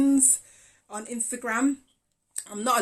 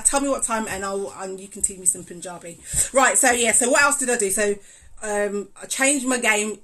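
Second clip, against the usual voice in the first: normal talking voice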